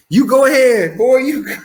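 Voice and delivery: high, loud proud cheer. Retold or imitated. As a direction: cheer your son on. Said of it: "And I'm like".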